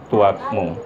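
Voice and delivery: normal talking voice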